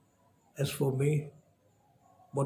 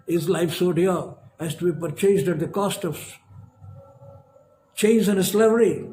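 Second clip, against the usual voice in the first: impassioned climax; 2 semitones higher than usual. Acted. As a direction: hurl the question at the crowd. Repeated question, climax.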